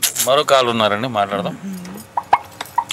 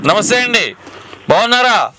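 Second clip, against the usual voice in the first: calling out; 6 semitones higher than usual. Real, not acted. Calling over a breaking phone line.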